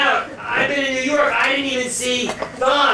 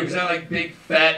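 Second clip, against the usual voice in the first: usual speaking voice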